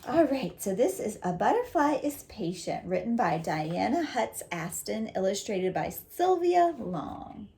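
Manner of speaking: ordinary talk, nothing marked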